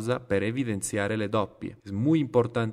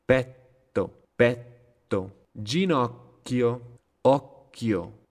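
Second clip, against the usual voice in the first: slow list with held doubles, as in pet-to, oc-chio; exaggerated double-consonant pause; much slower than usual. Shown as a demonstration. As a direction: stop inside each word, as in cat-tivo, capel-li. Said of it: "la pausa que hago, un poquito exagerada".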